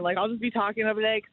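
ordinary talk

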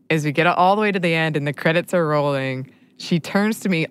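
normal talking voice

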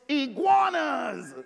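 slow, drawn-out "Iguanas" called out like a punchline; dramatic reveal. Real, not acted. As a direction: land "Iguanas" as the big surprise. Big reveal to congregation.

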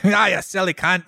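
put-on a laughing stab at an Australian accent that misses; hammed-up Aussie slang voice, unconvincing; failed Australian accent. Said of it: "Again, not an Australian accent".

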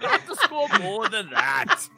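high, hammy Scottish growl; Scottish accent. Pretend. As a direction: play Groundskeeper Willie. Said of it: "You sounded like groundskeeper Willie".

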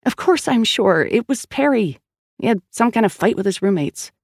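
exasperated sister standing her ground; insistent witness. Acted. Sal insists she saw her brother.

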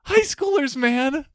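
normal talking voice

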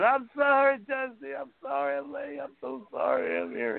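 very high, pleading mock wail; imitated bawling. Late man on his knees to Dusty, imitated bawling. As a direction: beg forgiveness in a high, mock-tearful voice. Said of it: "he's bawling and everything".

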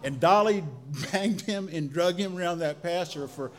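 chuckling through the telling; laughing. Real, not acted. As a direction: laugh breaking into words. Laughing at the uncle's fall.

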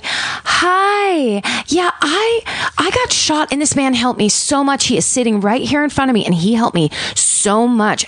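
high, sweet and calm, hiding panic; overly pleasant high phone voice; calm victim voice. Retold or imitated. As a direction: sound pleasant while in danger. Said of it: "the words she's saying don't match her tone".

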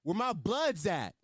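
slow mock rally cry; mock crowd call. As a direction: drag it out like calling to the crowd. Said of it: "he said".

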